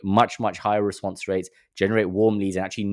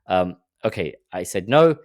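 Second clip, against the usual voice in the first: ordinary talk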